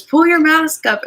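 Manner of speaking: sharp high retold yell. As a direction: bark it like a park worker. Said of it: "and this lady yelled at him".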